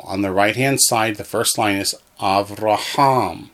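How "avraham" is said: "Avraham" with the weight on HAM; accent on last syllable. Shown as a demonstration. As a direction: stress the end, av-ra-HAM. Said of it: "accent on the last syllable".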